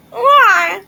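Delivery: sudden loud yelp; startled scream. Real, not acted. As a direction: yelp in shock.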